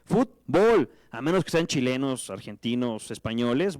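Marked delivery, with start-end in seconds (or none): 0.0-0.9 Fútbol said loud as futBOL, stressed on the final syllable; stress on last syllable. Shown as a demonstration. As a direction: model Fútbol the Mexican way, futBOL. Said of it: "no lleva tilde". (Fútbol dicho futBOL, con fuerza en la última sílaba)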